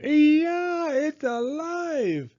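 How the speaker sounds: high panicked shriek, slowed; terrified scream. A narrator's reading. Man screaming when the doll suddenly speaks, high and panicked. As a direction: shriek in horror. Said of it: "The man screamed".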